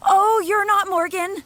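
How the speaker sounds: high, loud startled cry; frightened shout. Acted. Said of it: "there really is no need to shout".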